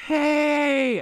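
long, high called-out angry "Hey!"; drawn-out mock-angry shout across a field. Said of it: "how to do an angry hey".

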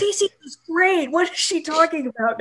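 high, put-on indignant fan; lightly voiced offended commenter; mock fan reply. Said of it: "all these replies like".

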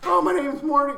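high-pitched cartoon character voice; Morty. Player jokingly answering the pirates, high put-on voice. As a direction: pitch it well up for a joke impression.

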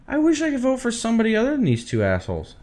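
high whiny voter voice; frustrated voter voice. Retold or imitated. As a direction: whine it like a fed-up voter.

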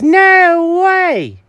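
high, loud delighted cry; cat thrilled about the attic, loud and high; excited disbelief. Acted. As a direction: shout it with glee. Jenkins excited.

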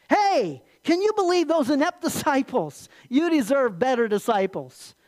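loud, high, incredulous needling; Satan goading Jesus. Acted. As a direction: stir up grievance as the tempter. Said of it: "Satan lied to, to Jesus".

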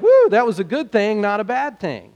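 mock delight at a lucky escape; relieved whoop. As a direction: cheer like you just escaped. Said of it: "You're gonna be like".

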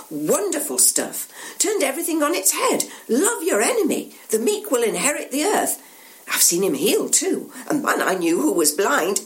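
high, animated retelling; eager pilgrim. Acted. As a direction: gush about what you saw. Pilgrim eagerly recounts Jesus.

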